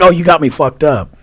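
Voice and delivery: loud retort. Retold or imitated. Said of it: "I'm like".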